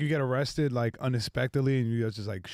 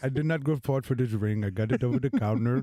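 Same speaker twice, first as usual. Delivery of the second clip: put-on Indian accent, laughing, pleading; wooing in an Indian accent. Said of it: "Indian gas station wedding proposals".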